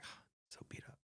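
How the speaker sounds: breathless whispered groan of fatigue; exhausted son. Retold or imitated. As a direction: sigh it out in a whisper, beaten. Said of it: "He's like".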